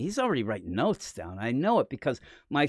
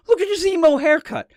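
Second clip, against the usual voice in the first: mocking fans; 9 dB louder than usual. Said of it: "all I heard about was Superman".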